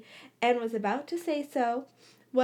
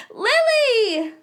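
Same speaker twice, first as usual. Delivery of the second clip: calling out; 12 semitones higher, 9 dB louder than usual. A narrator's reading. Amos calling across library.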